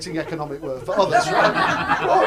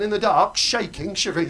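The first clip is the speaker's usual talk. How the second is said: ordinary talk, nothing marked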